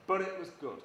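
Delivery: usual speaking voice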